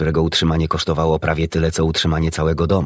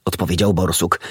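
ordinary delivery

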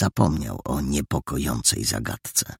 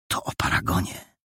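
hushed, voiceless aside; whisper; 5 dB quieter than usual. A narrator's reading. Hidden boy realising whom they mean, whispering. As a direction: whisper it to yourself from hiding. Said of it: "wyszeptał Mandżaro".

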